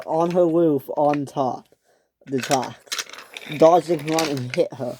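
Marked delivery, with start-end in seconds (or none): none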